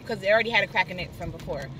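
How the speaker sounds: ordinary talk, nothing marked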